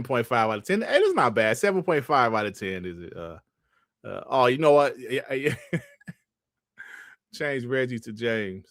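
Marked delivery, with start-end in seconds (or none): none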